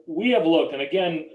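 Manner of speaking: ordinary delivery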